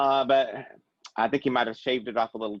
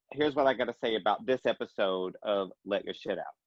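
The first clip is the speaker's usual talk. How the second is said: ordinary talk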